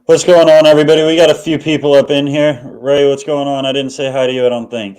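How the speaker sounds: loud, upbeat host welcome; host greeting. Acted. Host welcoming viewers, loud upbeat greeting. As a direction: call out to the room.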